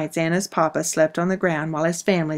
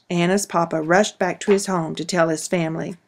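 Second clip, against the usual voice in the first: plain reading voice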